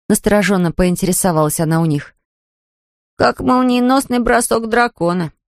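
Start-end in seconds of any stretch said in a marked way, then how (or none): 3.2-5.3 put-on high, stretched-out child's voice; boy's exclamation. A narrator's reading. Boy describes her lightning speed, exclaiming in high voice. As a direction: exclaim slowly like an impressed boy. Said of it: "воскликнул Келвин".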